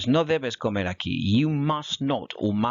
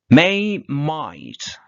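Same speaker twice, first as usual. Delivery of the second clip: loud heading; 4 semitones higher, 8 dB louder than usual. Shown as a demonstration. Teacher announcing the next topic to her class, loud. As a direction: call out the word May sharply and loud.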